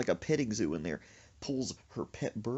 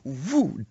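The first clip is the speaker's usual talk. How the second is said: whoosh of a dunk; 3 semitones higher, 7 dB louder than usual. Retold or imitated. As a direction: swoop a loud "woo" for the plunge. Imitated dunking sound in story.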